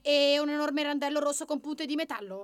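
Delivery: high, anxious rabbit voice; timid rabbit. A narrator's reading. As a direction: raise the pitch with mounting alarm. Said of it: "facendosi piccolo piccolo". (coniglio intimorito, voce acuta)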